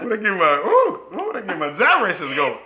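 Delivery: laughing, loud and playful; 笑いながら声を上げる; laughing ooh. Real, not acted. Reacting to wife's sound.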